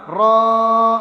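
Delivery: را loud, with a long-held vowel; drawn-out madd. Shown as a demonstration. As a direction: hold out را loudly on a steady tone. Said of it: "یہ ہم نے بنایا را مدہ". (را کو کھینچ کر)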